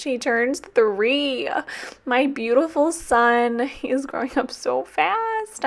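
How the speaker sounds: put-on high, slow baby talk; doting dog mom. A dog owner cooing over her dog turning three, in high baby talk. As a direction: coo it like talking to a baby.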